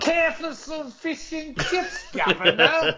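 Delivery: high, slow mock British accent. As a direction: offer it in a hammed-up British accent. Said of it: "some sort of accent".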